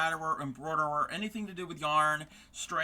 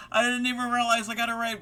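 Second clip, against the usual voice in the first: quick, high, laughing admission; 6 semitones higher, 5 dB louder, faster than usual. Real, not acted. Streamer laughing at herself for missing a raid, fast. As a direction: laugh it out, a bit embarrassed.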